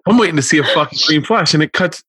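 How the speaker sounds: mock indignant dad. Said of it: "And he goes".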